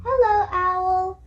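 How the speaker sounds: lightly voiced Pooh; Pooh greeting. A narrator's reading. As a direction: greet Owl gently as Pooh. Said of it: "Pooh said".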